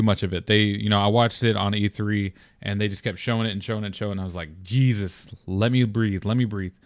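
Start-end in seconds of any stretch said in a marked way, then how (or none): none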